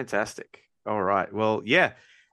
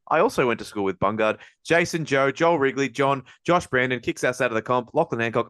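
usual speaking voice

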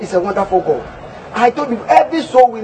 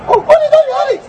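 excited high exclaiming; 3 dB louder than usual. Acted. Pastor showing gifts.

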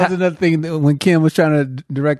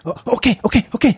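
higher, flustered okays; mock frantic; 5 semitones higher than usual. Frantic crew guy with earpiece, higher and flustered. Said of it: "he was like".

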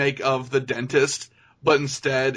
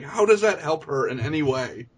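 ordinary talk

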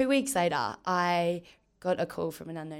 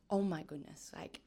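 whispered to herself in disbelief; awed whisper; 10 dB quieter than usual. Retold or imitated. To herself, on joining the star-studded team, an awed whisper. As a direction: breathe it out, stunned.

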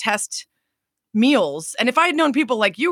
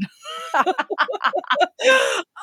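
helpless squealing laugh. Real, not acted. Joking about husband's plain accent.

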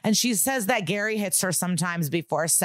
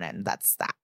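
bored; 10 semitones lower, 6 dB quieter than usual. Real, not acted. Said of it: "you could probably tell in my voice".

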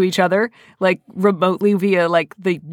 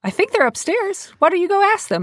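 retold in a much higher voice; helpful suggestion; 11 semitones higher than usual. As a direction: lift it high, imitating your own nudge. Retold nudge to helpless man.

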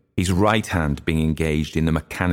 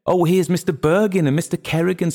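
high, eager hostess voice; high-voiced hostess pairing up dancers; busy young hostess; 13 semitones higher than usual. A narrator's reading. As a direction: greet the arrivals in a high, brisk feminine voice. Mary Jane organises the dance.

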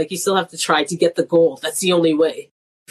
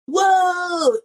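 mock surprise; 10 semitones higher than usual. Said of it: "they're like".

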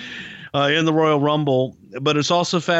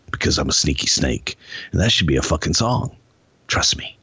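low, measured wrestler promo with pauses; Jake the Snake; 9 semitones lower than usual. Acted. Snake wrestler warning Randy Savage, low-voiced promo impersonation. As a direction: keep it low and sly, pausing before threats. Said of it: "I don't do a really good Jake".

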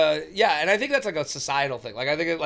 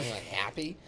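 annoyed echo; 6 semitones lower, 10 dB quieter than usual. Retold or imitated. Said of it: "I was, like, annoyed".

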